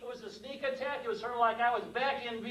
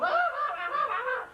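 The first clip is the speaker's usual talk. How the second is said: high, repeated mock dog snarls; dog shaking prey. Storyteller mimics dog shaking a puppy, high frantic snarls. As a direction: savage a puppy in rapid high bursts. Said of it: "It's shaking this dog. It's going".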